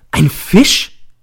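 high, loud startled cry; shouting. A narrator's reading. Narrator jumps up at the word, shouting. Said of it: "Ich springe auf und schreie".

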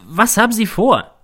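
high-pitched, urgent demand; shouting at him. A narrator's reading. Narrator demands to know the plan, shouting at him. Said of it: "schrei ich ihn an".

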